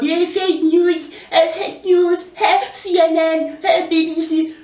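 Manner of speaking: very high squealing mock shout; mock Trump shout. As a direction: shriek it like a parody of Trump. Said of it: "You always shout".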